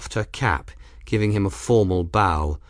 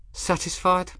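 teasing question; 9 semitones higher, 4 dB quieter than usual. A narrator's reading. Ransom teasing after disarming.

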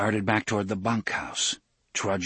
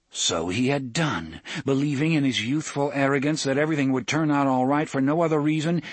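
ordinary delivery

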